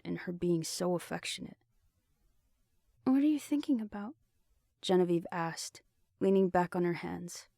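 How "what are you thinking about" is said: higher, lighter female character voice; young woman voice. A narrator's reading. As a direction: voice Genevieve a touch higher. Genevieve's voice in the story.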